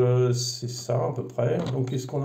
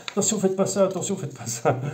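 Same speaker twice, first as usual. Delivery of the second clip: high-pitched, hammed-up warning; mock panicky caution, pitched up; mock alarm; 8 semitones higher, 4 dB louder than usual. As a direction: imitate an over-anxious manual's warnings. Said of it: "t'es mort de peur".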